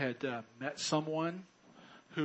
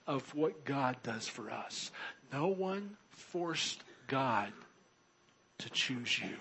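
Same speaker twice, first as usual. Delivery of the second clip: normal talking voice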